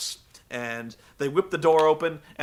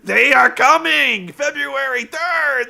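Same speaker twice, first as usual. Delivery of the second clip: higher, louder, slow villain proclamation; hammed-up wrestling villain promo; Crimson promo; 7 semitones higher, 6 dB louder, slower than usual. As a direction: announce the threat like a goofy vampire. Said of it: "He's like".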